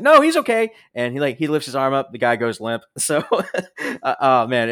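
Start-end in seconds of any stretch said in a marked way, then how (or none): 0.0-0.7 very high, loud mock reassurance; insistent cover-up. Jackie covering for the limp fake assassin, high insistent reassurance. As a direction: insist loudly in a squeaky-high voice. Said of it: "and Jackie's like".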